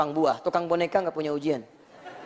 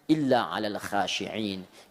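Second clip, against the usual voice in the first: slow low recitation; 9 semitones lower, much slower than usual. Shown as a demonstration. Reciting Quran verse.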